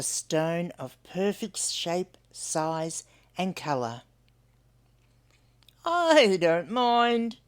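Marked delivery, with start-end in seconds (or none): 5.8-7.4 higher, very slow, gentle acceptance; a bilby at peace; content Hunwick. Acted. Hunwick to himself.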